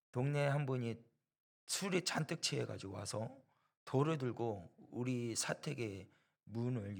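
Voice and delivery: ordinary talk, nothing marked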